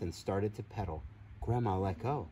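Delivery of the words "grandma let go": ordinary delivery, nothing marked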